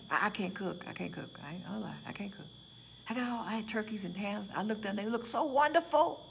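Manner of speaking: normal talking voice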